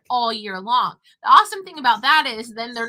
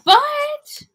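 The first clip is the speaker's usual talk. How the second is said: surprised gasp; 6 dB louder than usual. Real, not acted. Surprised remembering garden fruit.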